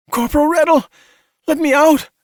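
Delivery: high, loud desperate yell; panicked scream. A narrator's reading. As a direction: scream for release, terrified. Trapped Cassie begs in panic.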